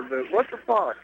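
ordinary talk

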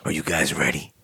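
low whispered wrestling-promo growl. Acted. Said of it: "he's cutting a wrestling promo over here".

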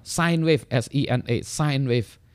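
sine as "sign", then spelt out; corrected English term. Shown as a demonstration. Lecturer giving the right English term, sine as "sign". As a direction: say sine wave with sine rhyming with line. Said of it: "Jadi apa dong?".

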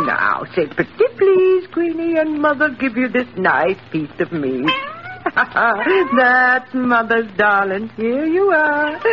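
put-on high, coaxing baby-talk voice; baby talk to dog. Feeding Queenie meat, high doting baby talk. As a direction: coo at the little dog like a doting mother.

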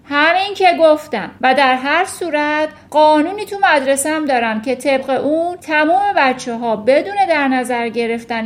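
loud, commanding tirade, easing at the end; roaring. A narrator's reading. Headmistress laying down school rule to teacher, roaring. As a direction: bellow out the rule. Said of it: "نعره زد".

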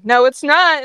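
loud, high contradiction; defiant retort. Acted. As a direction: fire back in defiance. Ember fights back.

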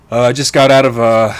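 breathless after a hard workout; panting between words; out of breath. Real, not acted. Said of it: "if I sound out of breath, I am out of breath".

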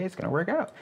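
high-pitched, naive reassurance; put-on high voice, mock hopeful; mock optimism. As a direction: imitate someone blithely hoping for the best. Mimics passive, naive optimism.

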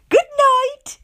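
very high, loud greeting; cheery hostess. A narrator's reading. Mother voicing Miss Contrary greeting her guest, chirpy.

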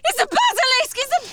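high, urgent alarm, rising to a cry; panicked warning. Acted. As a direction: stop him before he lights the torch. Realises the creature is a basilisk.

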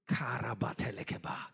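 low, soft prayer in tongues; praying in tongues. Real, not acted. Preacher praying in tongues mid-sermon, low and soft. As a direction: pray low under your voice.

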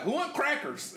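high-pitched vendor call; singled-out high hawker's voice; imitated salesman. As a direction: mimic a peddler offering wares. Imitates salesmen calling out wares.

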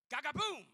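loud, high shouted boom; mock shotgun blast. Preacher miming a shotgun to the face, explosive shout. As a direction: fire it off like a double-barrel blast.

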